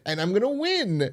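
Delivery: high mock-gleeful boast; happy. Roommate boasting about the contest, high happy gush. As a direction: lift it high, imitating her glee. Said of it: "were you so happy about".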